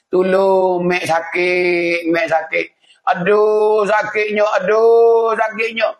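high, drawn-out wail for help; acted cry of pain. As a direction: moan long as if suffering in the grave. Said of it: "Kalau kita susah, kena tapa". (mengaduh sakit, meleret dan tinggi)

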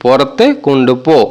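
পরতে কুণ্ডুপো repeated slowly, rising at the end; slow it down for learners; careful slow repeat of পরতে কুণ্ডুপো. Shown as a demonstration. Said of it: "আমরা বলবো".

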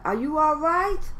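high loud worried question; anxious teacher checking on him. Acted.

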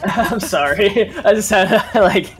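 giggling apology; laughing. Real, not acted.

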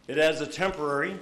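normal talking voice

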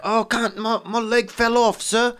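high Cockney voice, pleading; hammed-up high Cockney whine; mock Cockney beggar. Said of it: "but then if you're like that".

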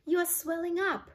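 high-pitched alarmed exclamation; panicked high storybook cry; horrified scream. A narrator's reading. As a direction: cry it out in shock. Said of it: "screamed Missus Beauregard".

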